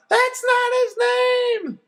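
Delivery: very high, slow shout; mother shouting a correction across yards; distant shout. A narrator's reading. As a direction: yell it back from far away. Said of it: "my mother shouts from far away".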